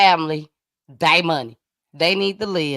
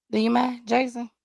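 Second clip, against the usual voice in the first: normal talking voice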